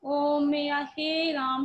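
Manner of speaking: ओम and राम held three times long; prolonged calling vowels in ओम, हे राम; pluta vowel demo. Shown as a demonstration. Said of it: "तीन गुना समय लग रहा है".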